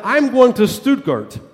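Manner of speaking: proudly high and loud; bold boast. Retold or imitated. Traveler to woman on train, boldly high and loud. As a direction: announce it boldly, showing off his German. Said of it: "And I grew more bold".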